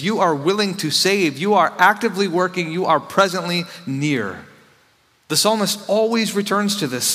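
high, loud, driving psalm reading; fervent preaching. Acted. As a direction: proclaim the psalms at full force. Preaching at full force, reading psalms.

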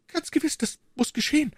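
high-pitched, urgent agreement; agitated exclamation. A narrator's reading. Queen agrees the studs must return, agitated exclamation. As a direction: exclaim it high without raising the volume. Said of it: "rief die Königin".

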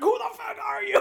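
high, loud furious yell; angry shout. Retold or imitated. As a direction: bellow it at the strangers. Gray wakes up, attacks them.

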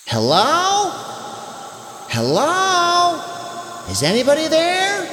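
high, questioning mock shout; calling out. A narrator's reading. Boy calls to whoever is in the woods, high storybook shout. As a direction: call out high without much volume. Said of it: "he shouted".

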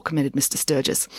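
ordinary delivery

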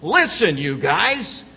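loud, emphatic attention-grabber. Acted. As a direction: call the group sharply to attention. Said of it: "with an emphatic kind of tone of voice".

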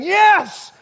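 very high, loud exultant shout; crying out. Acted. Blind beggar at the roadside hailing the Messiah, shouting out. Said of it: "sitting on the side of the road saying".

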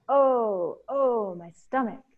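high, loud opening wail, easing off; echoed cry of pain. Acted. Two beings echo Ereshkigal, crying out in pain. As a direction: mirror the queen's moan. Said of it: "they cried out".

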